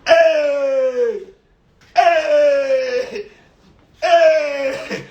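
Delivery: high shouted cries, mounting; mock shouts. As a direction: yell like a crowd scrambling. Mocking scramble for money.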